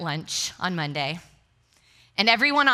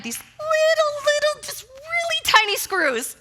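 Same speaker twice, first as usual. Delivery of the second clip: very high squeal on tiny things; hammed-up high-pitched frustration; squeaky exasperation; 4 dB louder than usual.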